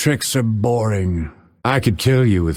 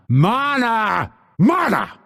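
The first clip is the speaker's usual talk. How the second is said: high, urgent cry; demanding yell; 11 semitones higher than usual. Acted. Fighter out of mana, demanding yell. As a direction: call out for it sharply.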